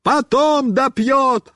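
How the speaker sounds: high, drawn-out retort; stretched high shout; yelling. A narrator's reading. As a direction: holler it back impatiently. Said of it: "ору я".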